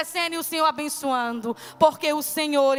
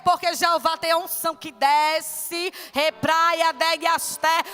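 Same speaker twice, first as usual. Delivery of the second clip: loud, raised preaching with bursts of tongues; fervent preaching; 2 semitones higher, 4 dB louder than usual. Acted. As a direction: preach it out to the congregation. Preaching surge, speaking in tongues.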